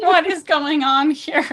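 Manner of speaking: puzzled bystander, playfully exaggerated; mock bewilderment. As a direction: stretch it out in mock disbelief. Imitates puzzled onlookers at shirts.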